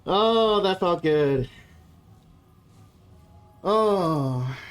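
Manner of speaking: out of breath, relieved; breathless after laughing hard; after a workout. Real, not acted. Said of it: "I'm catching my breath here".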